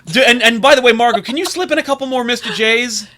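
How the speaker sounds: loud, high, hammed-up panic; frantic studio executive. Studio bosses demanding reshoots from the actress, mock frantic shouting. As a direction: order it urgently at the top of your range.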